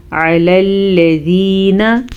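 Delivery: slow, drawn-out tajweed chant; Quran recitation. Shown as a demonstration. Teacher reciting for students. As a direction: intone the Arabic slowly.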